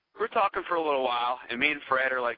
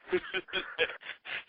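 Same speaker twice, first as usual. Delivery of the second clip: soft trailing laugh; real laughter; 6 semitones higher, 6 dB quieter than usual. Real, not acted. Laughing at the reporters cover story, soft real laughter. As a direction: let a quiet laugh run on.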